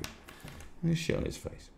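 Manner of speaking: slow conspiratorial whisper. Real, not acted. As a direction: hush it like a secret plan. Repeats the threat whispered.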